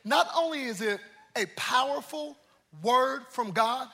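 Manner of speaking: ordinary delivery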